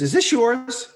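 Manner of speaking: high, quick rising question; casual question. Retold or imitated. Some guy to Vespa owner outside apartment, curious question. Passer-by asks about the Vespa.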